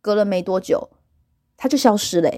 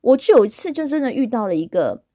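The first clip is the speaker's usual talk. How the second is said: normal talking voice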